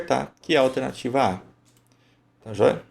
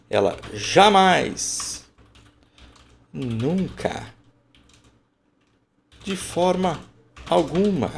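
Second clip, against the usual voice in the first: cada palavra martelada, alta e lenta; enfático; 7 semitones higher, much slower than usual. Shown as a demonstration. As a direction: bata forte em jamais, nunca, de forma alguma. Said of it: "olha como eu estou sendo enfático". (enfático, devagar, cada negação bem acentuada)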